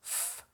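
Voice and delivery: a soft, long voiceless "f" hiss; just the "f", drawn out and quiet; f sound demo. Shown as a demonstration. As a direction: breathe out a gentle "f" to show the fricative.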